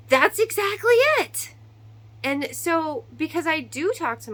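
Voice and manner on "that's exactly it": high, loud, emphatic; emphatic agreement. Retold or imitated. Mom cheering her daughter's answer, high and loud. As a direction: hit it like a triumphant yes.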